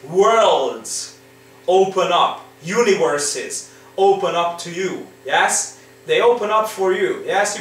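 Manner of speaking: normal talking voice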